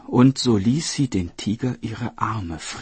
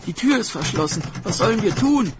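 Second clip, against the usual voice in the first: high, loud, climbing alarm; panicked sister crying out; 10 semitones higher, 5 dB louder than usual. Acted. Sister finds the door locked, panicked cry. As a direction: cry out trapped and frantic. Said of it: "rief die Schwester".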